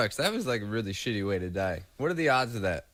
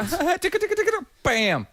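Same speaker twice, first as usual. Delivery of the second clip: put-on very high, loud sing-song baby talk; mock tickling voice; 7 dB louder than usual. Imitating Steve Irwin teasing the stingray in a high cooing voice. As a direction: coo the tickles, then bang.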